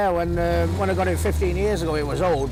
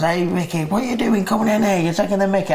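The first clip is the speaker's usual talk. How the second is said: landlord teasing; 5 semitones higher, 3 dB louder than usual. Retold or imitated. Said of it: "The landlord says".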